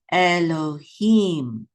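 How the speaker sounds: "Elohim" slowly, each syllable held. Shown as a demonstration. Teacher assembles the word for learners, drawn out. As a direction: sound out "Elohim" in three long syllables. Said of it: "this syllable right here is him".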